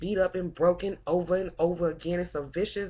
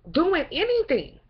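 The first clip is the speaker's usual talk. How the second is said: rising passion; 8 semitones higher, 5 dB louder than usual. Real, not acted. Impassioned rant about struggles.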